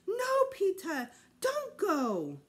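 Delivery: high, loud, slow begging wail; pleading child. A narrator's reading. Fudge begging Peter not to run away, wailing. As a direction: beg him not to leave.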